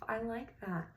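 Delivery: ordinary delivery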